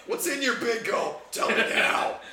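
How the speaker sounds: loud, high, pushy demand; demanding shout. Acted. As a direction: shout it like a grabby friend. GM voices a demanding character.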